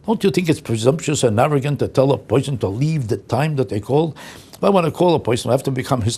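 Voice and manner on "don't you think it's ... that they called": ordinary talk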